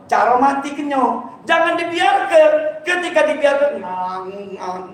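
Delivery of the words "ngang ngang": low soft nasal ring imitation; mock ringtone hum; phone ringing sound.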